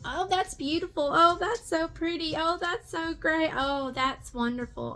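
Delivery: mocking hippie. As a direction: gush over everything like a dreamy hippie to mock her. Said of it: "it sounds like a freaking hippie".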